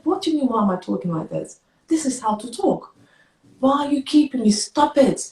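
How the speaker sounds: high-pitched indignant snapping back; retold mad retort. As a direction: argue back at the doctor, exasperated. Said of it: "I was just so mad".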